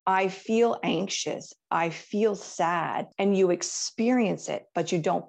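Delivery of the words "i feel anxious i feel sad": slow, with feel leaned on; stress on feel. Shown as a demonstration. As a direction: slowly say "I feel anxious. I feel sad," pressing feel. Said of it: "it's important that you can say".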